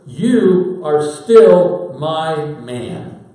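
slow, loud, every word weighted; Jesus assures Peter in front of the disciples, slow and firm; emphatic reassurance. Retold or imitated. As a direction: tell him he's still yours, slowly. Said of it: "as if to say".

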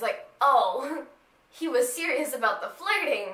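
normal talking voice

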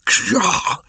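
high, loud outburst of a startled snake; irate python's sharp exclamation; angry. A narrator's reading. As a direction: explode in high-pitched indignation. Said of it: "said Kaa angrily".

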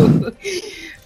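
high, loud burst of laughter; excited laugh. Real, not acted. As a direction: cackle with joy at the win. Laughing after the win.

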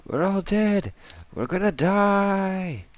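high, slow hammed-up dread wail; bad acting. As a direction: overact doom, stretched and high. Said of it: "excuse the bad acting".

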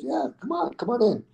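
high, softly voiced welcome; imitated invitation. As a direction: wave someone inside with a light, high call. Retold Sly inviting him inside.

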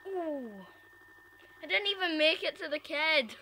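usual speaking voice

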